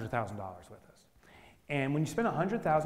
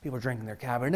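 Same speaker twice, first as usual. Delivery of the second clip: ordinary talk, nothing marked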